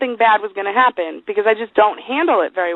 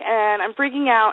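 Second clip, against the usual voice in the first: usual speaking voice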